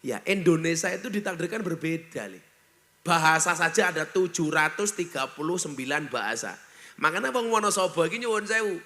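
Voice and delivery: usual speaking voice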